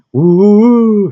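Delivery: high, loud wailing siren "woo"; imitated siren wail. Vlogger mimicking a passing ambulance, siren wail.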